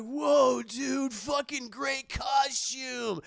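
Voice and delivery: high, drawn-out mock frat-boy awe; drunk party guys. Drunk guys admire Madea's costume, dopey awe. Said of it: "they're all drunk".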